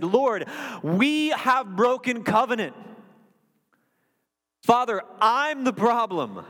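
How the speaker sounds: high, slow, drawn-out cry to God; impassioned confession. Acted. Preacher voicing Nehemiah's prayer to God, impassioned confession. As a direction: cry it out, stretching each word.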